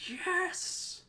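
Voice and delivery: high-pitched, drawn-out fan cheer; screamed cheer. Retold or imitated. Fan retelling favorite moments, mock excited scream. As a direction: squeal it like an elated fan. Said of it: "jumping up and screaming, like".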